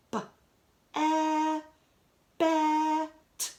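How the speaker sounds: "b, e, bet" sounded out then blended; sounding out. Shown as a demonstration. Teacher modelling for children how to decode a word, sounding out. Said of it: "I'm not sure about that word".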